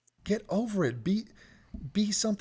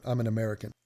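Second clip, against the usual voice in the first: lower-pitched, throwaway aside; offhand side note; 4 semitones lower, faster than usual. Acted. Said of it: "It's like a side note".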